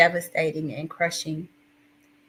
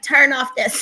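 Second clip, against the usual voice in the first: retold scolding, laughing; 5 semitones higher, 7 dB louder than usual. Retold pastor, breaking into laughter.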